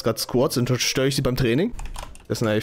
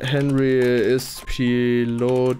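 very slow, stretched words; extremely slow, lower-pitched recitation; drawn out; 4 semitones lower, much slower than usual. Real, not acted. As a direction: drag out every word, as if typing along. Draws out words while typing.

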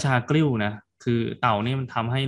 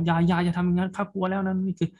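higher, panicked cry of a begging turtle; frightened turtle shouting; 8 semitones higher than usual. A narrator's reading. Turtle begs the ministers not to drown it, frightened shout. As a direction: plead in alarm as the turtle, voice jumping up. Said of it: "ก็ตะโกนโวยวายว่า".

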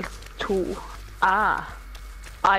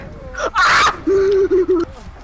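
shrieking laugh; 9 semitones higher, 4 dB louder than usual. Real, not acted. Caught, cries out.

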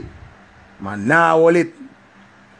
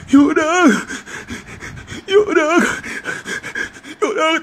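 high-pitched imitation of sobbing; mock bawling; 8 semitones higher, slower than usual. Teller mocking his weeping friend, mock bawling. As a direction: wail it like a heartbroken friend, hammed up. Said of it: "And when him ball".

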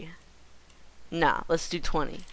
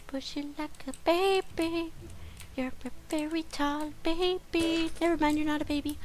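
put-on high baby talk; 11 semitones higher than usual. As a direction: coo at it like a baby. High voice talking to mob.

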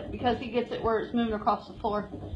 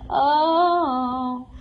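usual speaking voice

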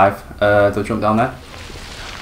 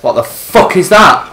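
frightened yelling; 9 semitones higher, 6 dB louder than usual. Real, not acted. Monster appears, frightened shouting.

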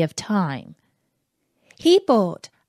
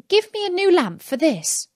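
princess voice; 9 semitones higher than usual. Acted. Princess asks the magician.

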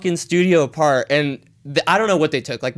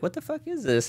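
baffled; 11 semitones higher, 9 dB quieter than usual. Retold or imitated. Surprised by unmarked car.

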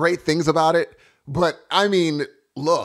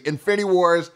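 ordinary talk, nothing marked

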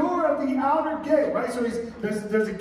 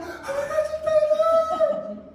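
overjoyed mock squeal; 3 dB louder than usual. Rhoda hearing Peter at the gate, comic overjoyed squeal. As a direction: squeal like an overjoyed servant girl, hammed up. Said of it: "because she's so overjoyed".

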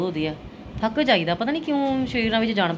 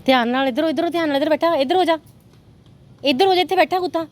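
urgent calling out; 9 semitones higher than usual. Real, not acted. Guiding child past dog.

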